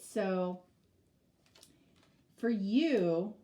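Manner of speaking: normal talking voice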